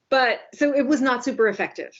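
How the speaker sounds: usual speaking voice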